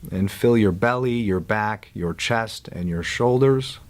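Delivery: calm guide with long silences between instructions; guided meditation. Real, not acted. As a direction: ease them into letting go, unhurried.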